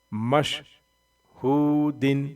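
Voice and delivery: مشہودن drawn out slowly, ending in a clear nasal -un; slow tanween reading. Shown as a demonstration. Teacher drilling pupils, reading مشہودن slowly. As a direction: read مشہودن slowly, syllable by syllable. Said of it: "Quran reading drill".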